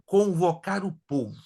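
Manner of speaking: usual speaking voice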